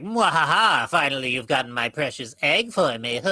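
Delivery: gleeful villain cackle then gloat; mad scientist. Acted. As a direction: cackle like the big bad unmasked. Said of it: "she could be like the big bad in disguise".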